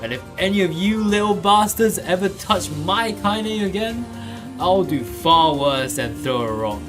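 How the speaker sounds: put-on loud, high, raging old-woman voice; a high-pitched snarling old woman threatening bullies; furious old woman. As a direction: roar at the children like a furious grandmother. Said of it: "she finally spoke in a voice thick with rage".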